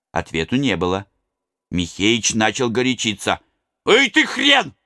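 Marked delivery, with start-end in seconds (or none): 3.9-4.7 high, loud angry yell; shouting high and loud at the miller. A narrator's reading. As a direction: yell at the old man through the door. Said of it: "закричал он".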